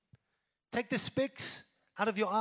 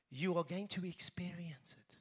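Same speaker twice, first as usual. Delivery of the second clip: whisper; 3 semitones lower, 8 dB quieter than usual. Real, not acted. Preacher drops to a whisper.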